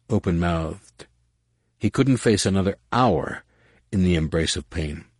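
ordinary delivery

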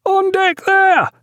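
very high, slow lookout's hail; distant-carrying, drawn-out high call; hail from masthead. A narrator's reading. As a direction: sing it out long and high to the deck below. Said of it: "the hail from the masthead".

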